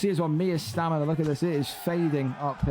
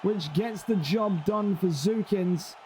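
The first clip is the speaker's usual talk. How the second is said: ecstatic play-by-play; 6 semitones higher than usual. Acted. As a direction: sell the Kimura reversal like a title moment. Submission reversal, big excited call.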